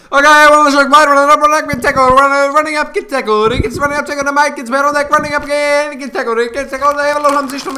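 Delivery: high, loud racecaller's gabble; horse racing commentator. Pretend. Demonstrating commentary to mates, loud high-pitched horse racing call. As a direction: call it like a frantic horse race. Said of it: "I'll commentate this like horse racing".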